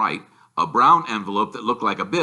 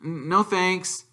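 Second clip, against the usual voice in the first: hesitant Harry; 8 semitones higher, slower than usual. Acted. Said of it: "said Harry".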